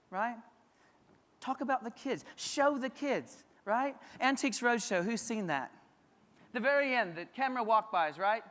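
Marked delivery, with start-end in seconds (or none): none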